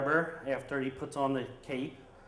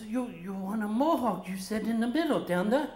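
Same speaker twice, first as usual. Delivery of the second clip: high, halting broken-English barber; 7 semitones higher than usual. Retold or imitated. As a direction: stammer it out like a flustered foreign barber. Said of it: "He spoke broken English".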